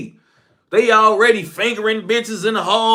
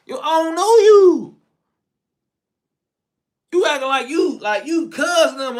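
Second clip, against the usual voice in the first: acted kid confrontation; 7 semitones higher than usual. Kid confronts stranger in his Lamborghini.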